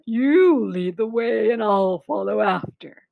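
lightly put-on monster voice after laughing; a hint of leftover laughter; amused Gruffalo. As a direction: humour them as an amused monster. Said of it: "bursting with laughter".

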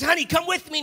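high-pitched, eager husband's call; excited husband. Retold or imitated. Husband urging his wife to come with him, excited. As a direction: urge her along, high and excited. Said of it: "He's so excited".

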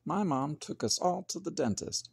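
ordinary delivery, nothing marked

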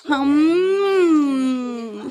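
normal talking voice

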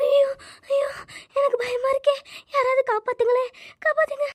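very high desperate calls; terrified cry for help. Acted. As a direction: cry out for anyone to save you. Calling for help in fear. (பயத்தில் உயர்ந்த குரலில் உதவி கேட்டு அலறுதல்)

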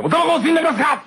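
高い声で大げさにこびる店員; 笑いながら客にこびるホステス風; へりくだる店員. Retold or imitated. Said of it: "っていうのが". (高い声で笑いまじりにこびる)

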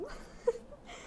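kurzes, hohes, tonloses Lachen; kurzes Auflachen. Real, not acted. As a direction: ein spöttisches Kichern. Lacht über die Kollegen. (kurzes spöttisches Lachen)

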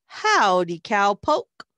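loud, playful cowgirl hail; cowgirl's greeting call. A narrator's reading. Masked cowgirl hailing her friend on Halloween, loud playful call. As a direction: call out a big hello to a friend.

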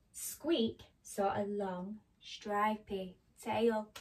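animated picture-book reading; story narration to children. A narrator's reading. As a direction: read it to small children with careful, dramatic phrasing.

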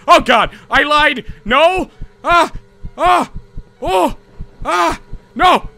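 high terrified yelps; panicked screaming. Real, not acted. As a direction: shriek in sudden fright. Monster escapes, player panics.

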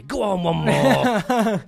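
slow, loud tempting whisper in your head; little inner voice. Retold or imitated. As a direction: drag it out like a tempting devil on the shoulder. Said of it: "the little voice will say".

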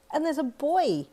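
put-on high, excited little girl's shout; excited child. Little girl tells her mum about the boy, excited high call. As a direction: squeal it with delight.

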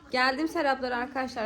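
usual speaking voice

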